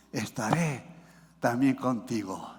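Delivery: low, soft preacher's delivery; hushed promise. Acted. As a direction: drop right down to give God's promise gently. Softly repeats God's promise to congregation. (promesa en voz baja y suave)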